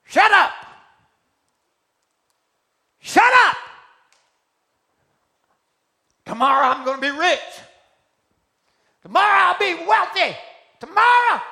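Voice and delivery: screaming high at the dog; furious shout. Acted. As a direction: miner screaming at his barking dog. Miner yelling at barking dog.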